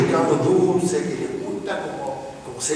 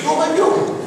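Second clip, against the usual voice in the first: mock teen protest; 11 semitones higher, slower than usual. Mimicking a teen refusing.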